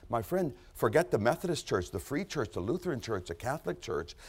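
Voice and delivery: normal talking voice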